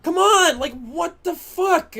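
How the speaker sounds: yelling at the screen in real rage; furious shout. Real, not acted. Streamer screaming at his ruined run, furious shout.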